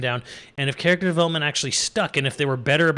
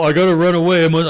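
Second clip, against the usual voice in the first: lightly voiced melodrama, much louder; mock dramatic Finn; 3 semitones higher, 10 dB louder, faster than usual. Mocking the movie's Finn with a loud melodramatic cry. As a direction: ham up a hero fleeing his destiny. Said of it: "have this whole".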